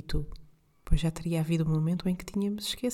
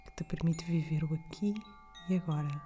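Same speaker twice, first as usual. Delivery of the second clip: calm guide with long pauses between breaths; guided meditation; 4 dB quieter than usual. Acted. (meditação guiada, voz suave e lenta)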